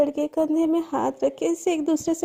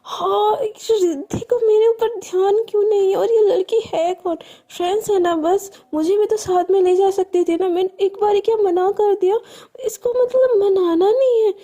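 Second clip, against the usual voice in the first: angry shout; 4 semitones higher than usual. Acted. Shreya alone after Riddhi leaves, shouting in jealous fury. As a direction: yell it out, hurt and jealous, voice cracking. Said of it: "एकदम चिल्लाती है".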